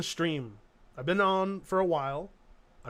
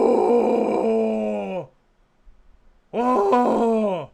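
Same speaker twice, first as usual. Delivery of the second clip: a loud whoop, then a loud oh; 6 dB louder than usual. Real, not acted. Streamer whoops at chat after the clip ends. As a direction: whoop like the show just ended.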